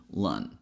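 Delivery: "lun" low and relaxed, with an uh vowel; relaxed schwa syllable. Shown as a demonstration. Teacher models the second syllable of calendar, relaxed "lun". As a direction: let "lun" drop, a loose L, schwa, N. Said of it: "a schwa sound".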